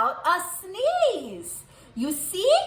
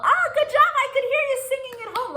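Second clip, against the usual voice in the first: much higher, bright delighted praise; excited praise; 12 semitones higher, much faster than usual. Real, not acted. Teacher praising children for singing, excited and high.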